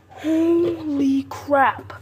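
slow stunned exclamation; shocked. Real, not acted. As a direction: drag it out in disbelief. After near drop.

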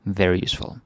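usual speaking voice

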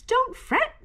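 high little mouse squeak; squeaky mouse. A narrator's reading. Mouse comforting Bear who has nothing, squeaking. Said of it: "Mouse squeaks".

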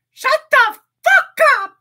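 cartoon rabbit yelling at Mickey; furious shout. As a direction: blow up at him at full volume. Oswald snaps at Mickey.

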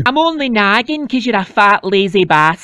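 nagging mum. Acted. Mum nagging her lazy husband, low loud voice.

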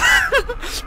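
high-pitched laugh. Real, not acted. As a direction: crack up after the joke. Kapanışta gülüyor. (tiz kahkaha)

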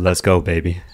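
bedroom voice. Pretend. Husband teasing his co-host, put-on bedroom voice. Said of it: "It's my bedroom voice".

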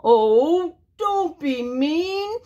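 high, slow whiny sniffle; sniffled plea. Acted. Wolf whines at the pigs, sniffly and slow. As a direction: whimper it as if near tears. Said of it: "sniffled Big Bad".

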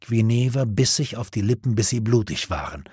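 ordinary delivery

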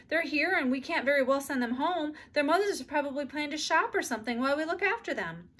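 put-on mother stuck with the children; flustered. A narrator's reading. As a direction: talk fast and harried. Flustered mother reasons aloud.

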